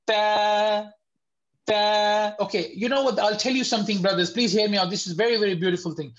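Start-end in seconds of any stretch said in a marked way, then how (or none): 0.1-2.3 Ta, ta said light and thin, with no mouth fullness. Shown as a demonstration. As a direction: keep ta light and crisp. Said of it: "this is a light letter".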